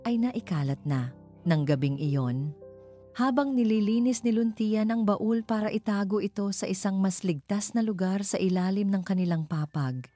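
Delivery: plain reading voice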